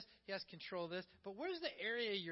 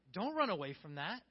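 ordinary delivery

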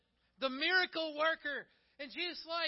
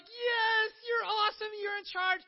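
much higher, louder, gushing cheer; excited crowd; 10 semitones higher, 7 dB louder than usual. Acted. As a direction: fawn over him like a fan. Crowd cheering Jesus as king.

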